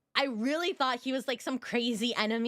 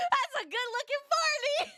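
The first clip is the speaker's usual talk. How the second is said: high, giggly laughing voice; 13 semitones higher than usual. Real, not acted. Streamer admiring a character's looks, laughing. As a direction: squeal it through real laughter. Still laughing about his age.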